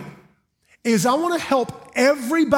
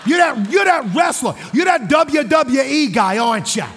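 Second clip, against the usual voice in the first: put-on loud, high Brooklyn accent, excited; New York-area accent; 5 semitones higher, 3 dB louder than usual. Seatmate thinks he recognises a wrestler, excited New York-area accent. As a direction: blurt it out in a brash Jersey accent. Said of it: "he is from Jersey or the Bronx or Brooklyn".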